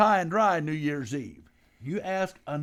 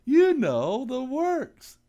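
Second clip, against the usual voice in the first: slow hammed-up pitch; 8 semitones higher, 5 dB louder, much slower than usual. Pop hams up the date pitch.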